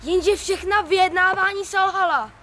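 loud, insistent back and forth; heated quarrel. Acted. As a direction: hammer each answer harder. Hádka dvořanů.

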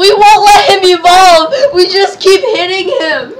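loud, high excited yelling; frantic shout. Real, not acted. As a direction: shout it in the heat of battle. Frantic gang-up on enemy.

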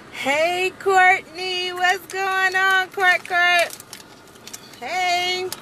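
high, drawn-out greeting to a viewer; high sing-song call to Courtney. Real, not acted. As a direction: call out brightly to a friend. Calling a viewer by name.

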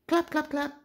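high clapping sounds. Acted. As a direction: mimic small claps. Sound words read aloud.

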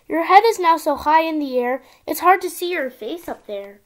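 ordinary delivery, nothing marked

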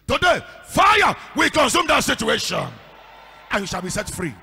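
loud, shouted declaration; full-force preaching. Real, not acted. Pastor pronounces freedom over the church, shouting. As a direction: thunder it like a revival pastor.

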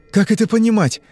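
high, loud indignant question; alarmed. Acted. Father questioning the hypnotist, alarmed and demanding.

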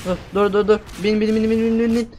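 ordinary delivery

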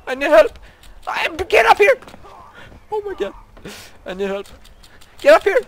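very high, loud screams for help; terrified screaming. Real, not acted. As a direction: scream for rescue in terror. Being attacked, begging help.

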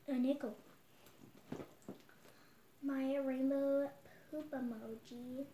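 ordinary talk

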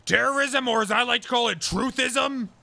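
mildly hammed-up defiant defendant; defiant conspiracy crank. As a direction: announce it like a self-made martyr. In-character defendant renames his charge.

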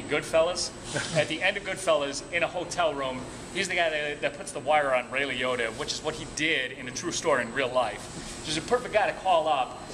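usual speaking voice

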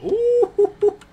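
very high, loud squeal; excited squeal. Retold or imitated. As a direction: squeal it out in a falsetto. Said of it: "And I told him".

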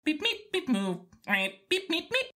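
high chirpy robot beeping; droid beeps. Acted. R2 chirping back at Threepio, high droid beeps. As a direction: chirp like a little astromech droid.

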